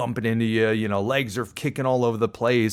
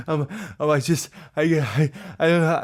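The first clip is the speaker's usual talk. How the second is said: acted breathless stammer, pitched up; too winded to talk; 6 semitones higher than usual. Exhausted swimmer answering the lifeguard, breathlessly stammering. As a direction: gasp out broken words, hammed-up. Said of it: "I can't even talk at this point".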